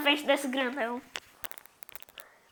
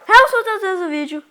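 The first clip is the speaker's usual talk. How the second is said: loud intro; 7 semitones higher, 12 dB louder than usual. Acted.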